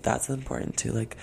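usual speaking voice

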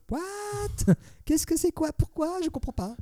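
high, slow squeaky bewilderment; baffled disbelief. Retold or imitated. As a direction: play it utterly baffled and squeaking. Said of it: "tu fais genre".

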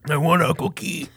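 lightly voiced Cookie Monster; a hint of the hungry monster. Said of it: "Cookie Monster voice".

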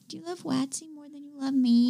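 soft, pouty, playful plea; goofy. Pretend. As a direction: play the jealous girlfriend for fun. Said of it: "when I'm being goofy".

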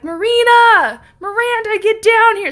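high, loud call from downstairs; grandma yelling. Retold or imitated. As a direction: shout the names up the stairs like grandma. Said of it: "my grandma starts yelling at us from downstairs".

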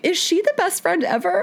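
high, delighted gushing question; excited gush. Retold or imitated. Narrator gushing over her best friend, high and excited. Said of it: "Which I was like".

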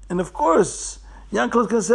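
usual speaking voice

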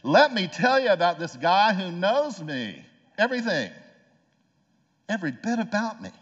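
high, loud, stretched-out testimony; excited proclamation. Acted. Samaritan woman bursting into Starbucks to tell the town, excited proclamation. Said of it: "bursts in the doors and said".

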